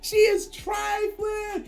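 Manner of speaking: slow, drawn-out, high imitation of the friend's shock; mock shocked. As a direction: stretch every word in mock disbelief.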